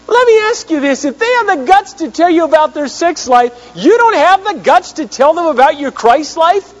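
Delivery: impassioned sermon climax; fiery preaching. Acted. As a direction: challenge the congregation at full force. Preaching at full force.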